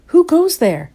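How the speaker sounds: high, loud storybook holler; hollered challenge. A narrator's reading. Scapito challenges a passing comet, hollering. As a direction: call out high and loud into space. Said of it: "hollered Scapito".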